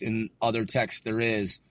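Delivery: ordinary delivery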